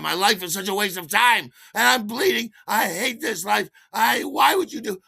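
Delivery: high, loud self-loathing tirade; hammed-up hysterical shouting; screaming rant. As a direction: wail and yell in drunken despair. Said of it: "screaming and shouting and yelling".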